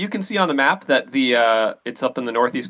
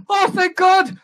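high relieved outburst; relieved cry; 3 dB louder, slower than usual. Acted. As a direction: gasp it out, saved at last. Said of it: "the guy inside goes".

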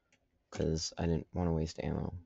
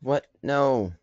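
dismayed no; 8 semitones higher, 9 dB louder than usual. Real, not acted. Loses round in shooter game.

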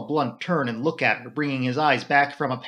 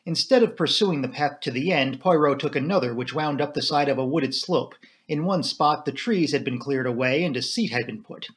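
ordinary delivery, nothing marked

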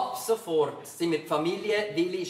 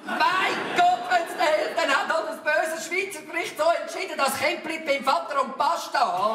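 exasperated outburst; 8 semitones higher, 4 dB louder than usual. Acted. Exasperated argument about the story.

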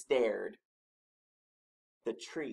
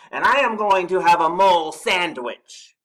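loud, higher monster threat; growled threat; 8 semitones higher, 16 dB louder than usual. A narrator's reading. Snorklum telling Mole his supper plan, growled loudly. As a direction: growl it out hugely to scare your prey. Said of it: "he growled".

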